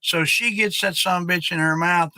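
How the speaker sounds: ordinary talk, nothing marked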